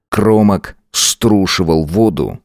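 usual speaking voice

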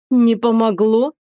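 slow, sorrowful question; queen sadly asking the bride. A narrator's reading. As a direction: ask with mournful sympathy. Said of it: "печально осведомилась правительница".

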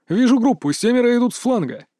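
high-pitched battle report; urgent callout. A narrator's reading. Scout reports fighters on the flank, high urgent call. Scout calls out enemy sighting.

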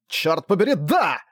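roaring curse, pitch up. A narrator's reading. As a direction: bellow it out in frustration. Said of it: "Взревел Уэйн". (рёв)

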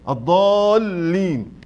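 الضالين, slow, with a long-held aa; madd with shadda. Shown as a demonstration. Teacher explaining the merged lam, long aa in الضالين. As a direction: stretch the aa of الضالين, then land on the doubled lam. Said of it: "دلل على هذا الإدغام بالشدة".

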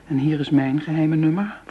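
normal talking voice

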